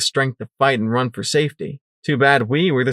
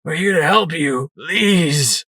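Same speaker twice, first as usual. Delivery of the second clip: plaintive wail; 5 semitones higher, slower than usual. Acted. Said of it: "wailed Sanguine plaintively".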